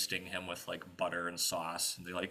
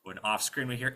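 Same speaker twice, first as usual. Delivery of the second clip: ordinary delivery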